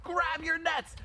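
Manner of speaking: high, drawn-out alarm yell. A narrator's reading. Dog catchers spotting dogs in the park, yelling. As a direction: raise the alarm loud and very high. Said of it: "they yell".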